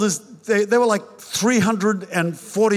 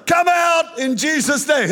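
yelling; 9 semitones higher than usual. Retold or imitated. Retold elders yelling a deliverance prayer at him. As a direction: cast it out with a high yell. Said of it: "they started yelling".

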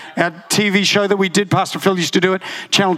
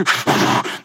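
quick smoker's puff; imitated cigarette drag. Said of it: "She took the deepest drags of her ciggy".

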